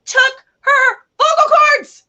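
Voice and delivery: high, loud, slow outraged cry; dramatic shout. Real, not acted. Telling a friend the gruesome twist, loud outraged cry. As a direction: blurt it out in mock horror.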